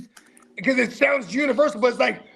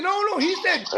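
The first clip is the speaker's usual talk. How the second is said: normal talking voice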